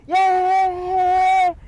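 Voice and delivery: high, loud cheering yay; wild crowd yay. Acted.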